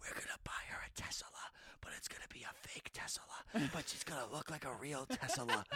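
put-on fast hushed whisper of a kid's secret plan; conspiratorial kid whispering a prank plan. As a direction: whisper it quick into someone's ear. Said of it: "he would come and whisper in our ear".

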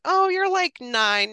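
high, loud opening, easing down; doctor's surprised verdict. Retold or imitated. Doctor after checking the patient, surprised announcement. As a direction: announce a finding with mock surprise.

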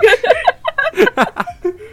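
high squealing laugh like a dying rat; squeaky laugh. Real, not acted. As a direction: let out a shrill, squeaking cackle. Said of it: "I sound like a dying rat".

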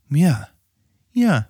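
plain reading voice

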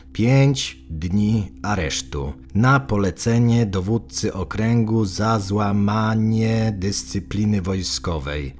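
spelling it out into the ledger, very slowly; a clerk dictating word by word, slow and measured; slow, deliberate officer dictating entries; slow dictation. Acted.

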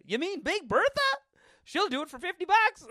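high-pitched, loud comic disbelief; incredulous mock reply. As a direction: squeal it in hammed-up astonishment. Acts out stunned guy's reply.

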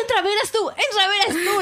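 high insistent coaxing; pleading. Retold or imitated. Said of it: "Y le dice".

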